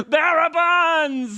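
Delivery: high, long drawn-out singsong; mock exclamation. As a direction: stretch it out high in playful mockery. Jokey echo of quoted phrase.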